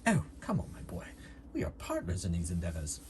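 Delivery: lightly voiced doctor, coaxing a partner; lightly acted well-spoken doctor; cajoling doctor character. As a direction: coax in a put-on doctor's voice. Doctor answers Xander in character.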